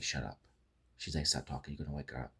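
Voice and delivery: low, hushed retelling of a warning; quiet, subdued mock warning; retold hushing. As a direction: softly voice her telling him to keep quiet. Retold plea not to wake her.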